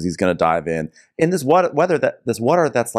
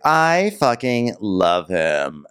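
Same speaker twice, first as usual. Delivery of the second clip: slow gushing; much slower than usual. Retold or imitated. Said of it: "Oriana's like".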